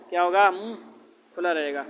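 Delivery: ordinary talk, nothing marked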